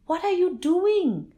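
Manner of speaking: high, loud, shocked cry; horrified shout. A narrator's reading. Visitor sees villagers chopping trees, horrified high shout. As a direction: shout in horror at the tree cutters. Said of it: "The Kampong Jelutong man was horrified".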